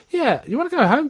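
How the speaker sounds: high, coaxing voice; Bill Shaker coaxing. Retold or imitated. Bill Shaker coaxing Dougie homeward, high. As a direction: lift it up, coaxing a confused friend. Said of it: "He goes".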